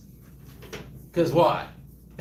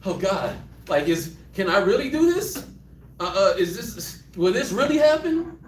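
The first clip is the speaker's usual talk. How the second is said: nervous Gideon; 7 semitones higher than usual. Retold or imitated. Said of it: "He's like".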